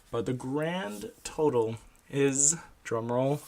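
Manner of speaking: drawn out, slow suspense; slow drum roll build. Acted. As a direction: stretch it out for suspense. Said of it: "imagine the drum roll sound".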